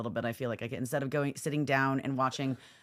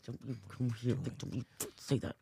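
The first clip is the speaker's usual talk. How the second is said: mock stern parent; 6 semitones lower, 10 dB quieter than usual. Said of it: "That's like".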